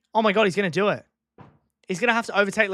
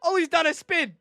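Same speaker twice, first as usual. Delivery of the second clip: louder, higher alarmed reaction; shocked outcry; 11 semitones higher, 5 dB louder than usual. Real, not acted. Watching the race as a car spins, shocked exclamation. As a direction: react to the sudden spin in alarm.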